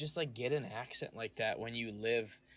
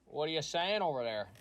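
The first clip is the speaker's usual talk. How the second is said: louder, higher mock Canadian challenge; belligerent hockey fan; 6 semitones higher, 5 dB louder than usual. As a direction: pick a fight like an offended hockey fan.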